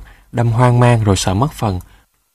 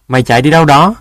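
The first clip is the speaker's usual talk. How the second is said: raised, louder challenge; shouting after someone; 4 semitones higher, 6 dB louder, faster than usual. A narrator's reading. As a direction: call after a runaway. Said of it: "vội chạy theo la". (la gọi với theo)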